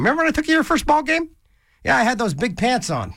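high, fast teasing parent voice; hammed-up fond parent; mock doting parent. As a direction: play the proud dad reminiscing.

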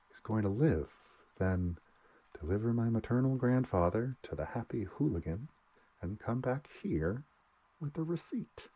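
high, loud mock-cheerful list; bitter sarcasm. A narrator's reading. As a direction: sneer through a breezy tone. Welling bitterly lists his tasks.

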